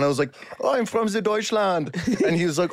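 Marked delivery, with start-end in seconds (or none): 0.6-1.9 high, put-on German character; German accent. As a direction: stay in the comic German accent. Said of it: "I have to keep this character on".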